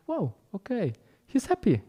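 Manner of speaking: a passerby quietly won over; impressed passerby. Retold or imitated. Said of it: "they were like".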